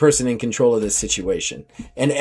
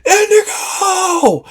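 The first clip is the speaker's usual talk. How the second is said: long slow shout, far higher; pirates cheer a name; much slower than usual. Acted. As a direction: hail the new captain at full cry. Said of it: "every pirate's like".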